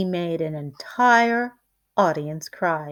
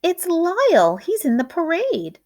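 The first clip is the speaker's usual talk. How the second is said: high, louder excited spotting; excited call; 9 semitones higher, 4 dB louder than usual. A narrator's reading. Onlooker calls to the crowd, excited. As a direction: shout it out as you spot him in the parade. Onlooker spots Lyle in parade.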